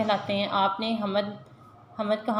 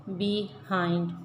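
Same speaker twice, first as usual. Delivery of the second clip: Behind slowly, as बि, hind; split into syllables; 2 semitones lower, 3 dB quieter, much slower than usual. Shown as a demonstration. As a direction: say behind in two slow, separate beats. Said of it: "Aahista Aahista पढ़ेंगे".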